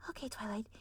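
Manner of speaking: whispered self-talk; muttering to herself. Acted. Twilight talking herself through it, whispered. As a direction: coach yourself under breath. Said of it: "you gotta think".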